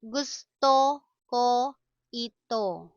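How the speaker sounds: word by word with pauses. Shown as a demonstration. Slow model, then natural speed.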